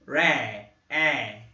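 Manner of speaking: Ré with an open é, stretched; teacher isolating the vowel sound; sound demo. Shown as a demonstration. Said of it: "ele tem esse som também".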